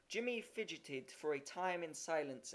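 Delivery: plain reading voice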